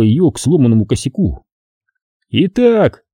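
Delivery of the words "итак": grand announcing tone, pitched high; proclaiming. Acted. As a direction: announce it like a host. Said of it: "провозгласил Тре".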